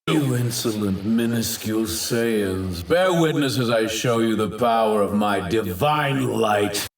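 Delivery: normal talking voice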